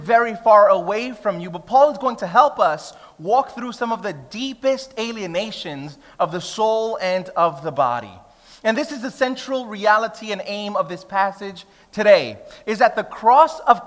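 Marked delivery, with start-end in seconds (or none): none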